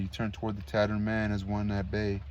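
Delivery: ordinary delivery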